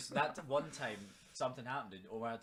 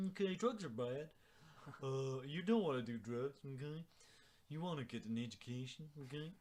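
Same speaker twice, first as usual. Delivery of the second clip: Mister Maggie voice; 5 semitones higher than usual. Retold or imitated. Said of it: "in the Mister Maggie voice".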